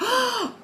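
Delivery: high-pitched gasp of wonder; amazed gasp. Acted. Inside a brand new house, gasping in awe. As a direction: gasp high, as if you can't stop.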